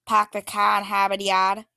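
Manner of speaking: Park the car in Harvard Yard with every r dropped, Pahk the cah; a light Boston accent, broad ah vowels and no r. Shown as a demonstration. Teacher demos the classic Boston phrase, Boston accent. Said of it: "They talk like this".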